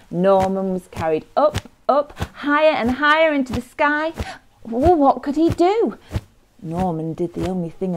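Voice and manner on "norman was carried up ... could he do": put-on rising, suspenseful children's storytelling; dramatic story narration. A narrator's reading. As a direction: climb higher and louder as the danger mounts.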